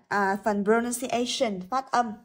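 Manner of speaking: normal talking voice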